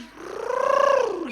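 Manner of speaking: loud rolling rrr of a motorbike speeding off; mimicking the ojek racing away; imitated motor roar. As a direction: trill the engine noise, high and loud.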